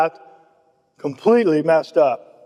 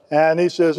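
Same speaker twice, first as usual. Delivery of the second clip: usual speaking voice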